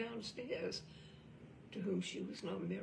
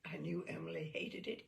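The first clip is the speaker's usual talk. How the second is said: ordinary delivery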